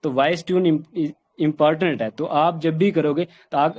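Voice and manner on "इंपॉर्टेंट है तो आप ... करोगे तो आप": ordinary delivery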